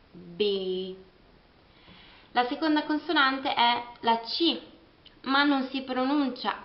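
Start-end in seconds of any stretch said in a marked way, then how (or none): 0.1-0.9 B said as bee; English B. Shown as a demonstration. Teacher showing English letter B. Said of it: "si pronuncia con B".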